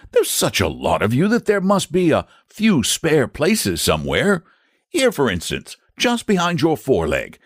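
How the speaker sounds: high knightly voice, calm and practical; a gentle knight explaining; Saint George, reasonable. A narrator's reading. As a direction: knight reassuring the dragon.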